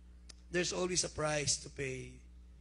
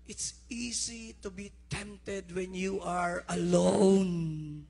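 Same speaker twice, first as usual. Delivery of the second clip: slow, louder preaching; slow build-up; 6 dB louder, much slower than usual. Real, not acted. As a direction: draw it out before the climax. (mabagal na pangangaral)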